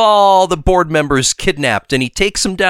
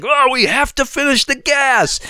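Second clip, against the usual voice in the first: higher, put-on Norman voice; 10 semitones higher than usual. Retelling the episode to his co-host, hammed-up high Norman. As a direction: play Norman as a mock-insistent scientist, pitched up. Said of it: "And Norman's like".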